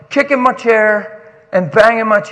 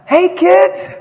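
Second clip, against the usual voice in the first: excited greeting; 9 semitones higher, 6 dB louder than usual. Real, not acted. Preacher greets friends' kids from the stage, excited call.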